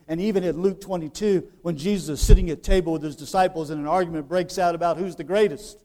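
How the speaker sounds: usual speaking voice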